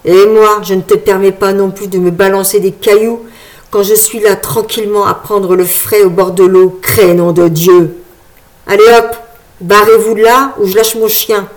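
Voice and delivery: put-on loud, scolding old man; angry old man. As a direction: tell them off gruffly.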